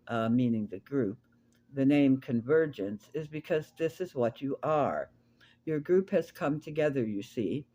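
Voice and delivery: a measured, deliberate teaching voice, lightly set apart from plain talk; Eve channeled. Acted. Channeled Eve teaching the Convergence group, measured and deliberate. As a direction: speak as a calm spirit guide addressing the group.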